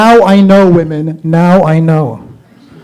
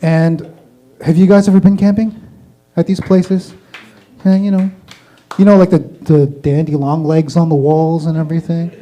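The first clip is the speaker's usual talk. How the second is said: usual speaking voice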